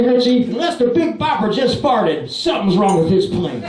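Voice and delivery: normal talking voice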